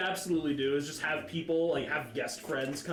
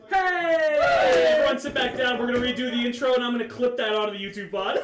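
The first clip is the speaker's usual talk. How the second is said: raised, high-pitched loud call; calling to order; 9 semitones higher, 11 dB louder than usual. Real, not acted. Host hollering at friends to redo the intro, loud call. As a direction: holler to round everyone up.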